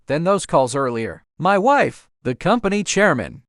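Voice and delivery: plain reading voice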